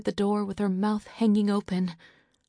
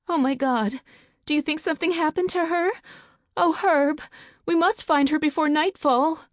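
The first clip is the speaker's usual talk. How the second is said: high, louder, fast panic; panicked; 10 semitones higher, 5 dB louder than usual. Acted. Wife begging her husband to find their daughter, high panic. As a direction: plead with rising panic.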